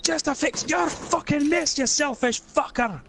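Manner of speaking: Scottish rant. Acted. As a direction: berate the selfish hobbit in a Scot's voice. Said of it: "first time I've used the accent".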